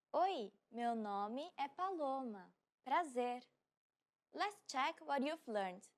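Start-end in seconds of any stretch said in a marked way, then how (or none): 0.1-3.4 slow, careful Portuguese; slow model repeat. Shown as a demonstration. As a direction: spell it out slowly for learners. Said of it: "repeat of line 108".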